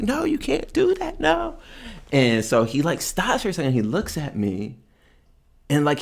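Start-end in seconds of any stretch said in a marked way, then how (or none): 0.0-1.6 shrill-pitched retold yell, much higher. To the man bouncing the unconscious guy, a high yell. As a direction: yell it up high to stop him. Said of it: "I'm yelling".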